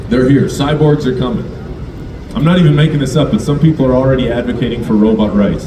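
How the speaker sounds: normal talking voice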